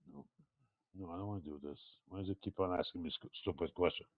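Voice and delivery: low, very soft aside; muttering to himself. Real, not acted. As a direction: grumble privately under your breath at the computer. Aside to himself about the software.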